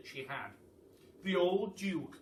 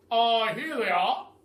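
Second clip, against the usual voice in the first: higher, loud triumphant cry; chief of police crying out; 8 semitones higher, 15 dB louder than usual. A narrator's reading. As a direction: call it out proudly as the police chief. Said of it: "cried the chief of police".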